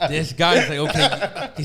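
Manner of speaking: laughing through the quote; amused, high and loud. Retold or imitated. Laughing while quoting officer.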